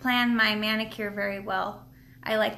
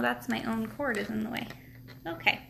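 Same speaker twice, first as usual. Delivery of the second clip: ordinary talk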